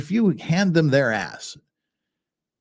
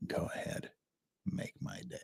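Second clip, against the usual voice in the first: much softer, breathless whispered menace; Clint Eastwood; 20 dB quieter than usual. Retold or imitated. Tough guy facing down an aggressor, whispered threat. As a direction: whisper it low and cold as a quiet threat. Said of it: "if it's Clint Eastwood, he just rolls up and says".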